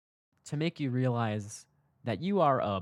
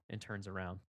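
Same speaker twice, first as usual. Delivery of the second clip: usual speaking voice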